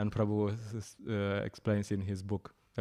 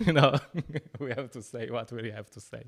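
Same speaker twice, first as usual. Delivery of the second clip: normal talking voice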